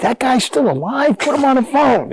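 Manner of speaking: retold astonishment. Player learns old coach is there, high-pitched astonishment. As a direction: act out amazed demand to hear him.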